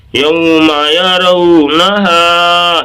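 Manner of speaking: measured, stretched teaching chant of یوم یرونہا; drawn-out recitation. Shown as a demonstration. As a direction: slowly chant یوم یرونہا with sustained vowels. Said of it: "اس طرح سے".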